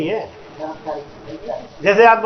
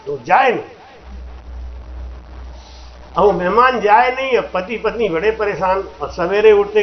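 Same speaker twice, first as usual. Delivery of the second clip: ordinary talk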